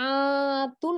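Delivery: আতুন with a very long opening আ; drawn-out vowel. Shown as a demonstration. Teacher explaining the extra madd, opening আ of আতুন held long. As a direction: stretch the আ of আতুন, then a short তুন. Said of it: "এরকম করে কেন পড়লাম".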